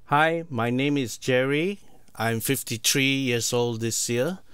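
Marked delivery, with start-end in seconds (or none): none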